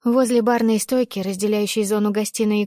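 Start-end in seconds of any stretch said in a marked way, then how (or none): none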